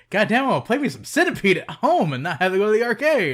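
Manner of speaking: loud, high, hammed-up gleeful dad; mock gleeful dad. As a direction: ham up a dad who is thrilled with himself. Dad's glee, voiced by friend.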